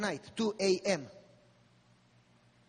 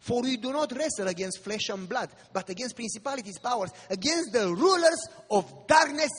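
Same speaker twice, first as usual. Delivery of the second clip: louder preaching, with rising force; sermon preaching; 2 semitones higher, 5 dB louder than usual. Acted. Preacher warns the congregation of the final crisis, preaching louder. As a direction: call the flock to readiness.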